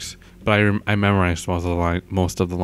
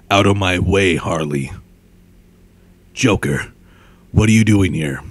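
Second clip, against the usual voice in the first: loud, gruff vigilante command; Batman; 7 dB louder than usual. Shown as a demonstration. Hero ordering villains out of the way, gruff. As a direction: confront them in a dark hero voice. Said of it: "I'll try to do a Batman voice".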